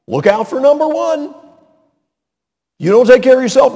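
hammed-up brash voice pushing selfish mottos; mocking worldly slogans. Preacher mimicking the world's advice to the congregation, brash and loud.

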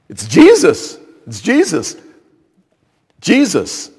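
mock herald announcing someone to a crowd; acted calling out. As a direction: call it out in separate bursts. Voicing those leading the parade.

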